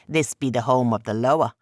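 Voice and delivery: a proud spirit showing off his home; trickster god Eshu. Acted. Trickster god's voice.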